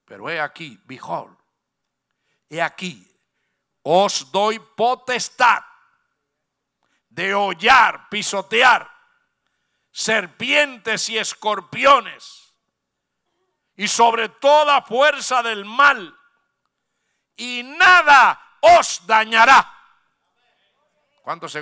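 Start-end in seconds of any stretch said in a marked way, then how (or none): 0.0-3.1 lower, softer quoting of the Lord; low solemn voice of the Lord. Retold or imitated. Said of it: "qué le dijo el Señor". 3.8-19.7 very slow, growing louder to a shout; the Lord granting power, slow then loud; slow building proclamation. Retold or imitated. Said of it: "qué le dijo el Señor".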